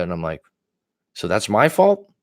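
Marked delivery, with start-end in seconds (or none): 1.1-2.1 incredulous, higher rising "So that's my fault?"; sarcastic inner question. Retold or imitated. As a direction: ask it in disbelief, a touch sarcastic. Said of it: "Like, in my head".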